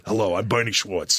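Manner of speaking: low garbage disposal voice; Tony Curtis impression. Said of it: "that garbage disposal voice".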